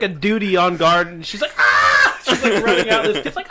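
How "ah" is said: loud mock scream of alarm; imitated scream. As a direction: scream like the guard running out. Imitating duty guard screaming.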